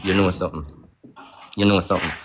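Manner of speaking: low, knowing muttered realisation; knowing aside. Retold or imitated. Telling himself he caught Pops lying, low knowing tone. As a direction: say it low, as if it just clicked. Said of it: "I say to myself, say".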